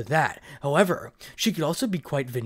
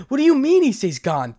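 loud, high furious demand; thundering; 11 semitones higher, 9 dB louder than usual. A narrator's reading. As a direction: roar it at him. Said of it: "thundered Rias".

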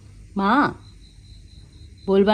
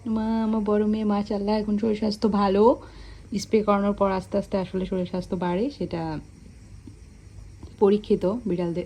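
normal talking voice

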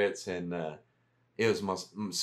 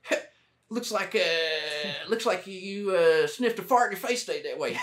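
George Bush Texan voice; 7 semitones higher than usual. Retold or imitated. First sergeant teasing a soldier, in a high Texan twang. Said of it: "he's more like George Bush".

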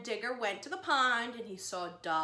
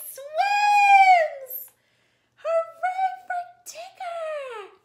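much higher, louder joyful shout; cheering; 14 dB louder, much slower than usual. Acted. Teacher cheers Digger's first swim, loud high joyful shout. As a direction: cheer like the whole class is celebrating.

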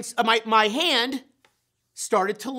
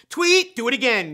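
louder barked coach command; coach yelling; 4 semitones higher, 6 dB louder than usual. Retold or imitated. Coach stopping the team at practice, loud bark. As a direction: call it out like a coach on the field. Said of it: "yells the coach".

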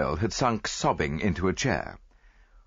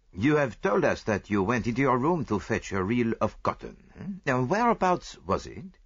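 lightly voiced foreign detective, patient; detective leading the maid through her story, patient; Poirot questioning; 5 semitones higher than usual. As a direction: ask her gently, step by step.

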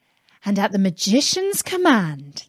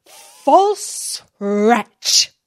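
grand languid royal commands; 3 dB louder than usual. Acted. Sultan accuses Aladdin.

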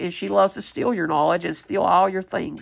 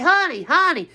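higher lilting greeting; her greeting; 6 semitones higher, 3 dB louder than usual. Retold or imitated. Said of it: "how many times I say".

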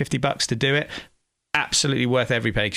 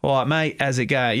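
East London Cockney greeting; matey Cockney demonstration; Cockney accent. Shown as a demonstration.

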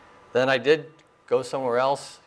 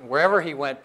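usual speaking voice

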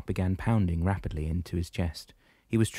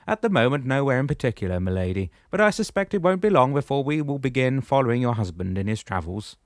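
higher, louder commanding shepherd voice; booming old shepherd, pitched up; 6 semitones higher, 6 dB louder than usual. Acted. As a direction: address him firmly from above. Damianos speaks, voiced figure.

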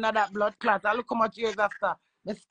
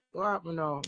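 ordinary delivery

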